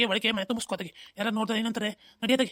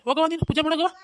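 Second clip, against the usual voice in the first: higher, louder shooing; shooing shout; 6 semitones higher, 6 dB louder than usual. Acted. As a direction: shout her off to the puja. Father shoos wife away. (ಜೋರಾಗಿ ಕಳಿಸುವುದು)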